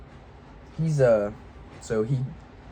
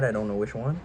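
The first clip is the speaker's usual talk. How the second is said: ordinary talk, nothing marked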